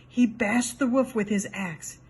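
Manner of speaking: ordinary delivery, nothing marked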